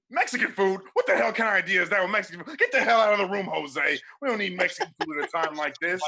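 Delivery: loud, high, fast boss yelling; angry boss. Acted. Boss shouting at Jose in a meeting, angry. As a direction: berate the employee for a dumb idea.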